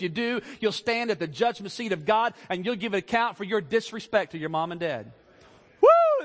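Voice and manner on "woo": sudden high, loud preacher's whoop. Real, not acted. Preacher whooping at his own tough preaching. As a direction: let out a joyful whoop at your own point. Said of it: "That's tough preaching right there".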